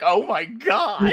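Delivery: drawn-out shocked oh my God; mock shock. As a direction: stretch it out in amazement. Listener shocked at story.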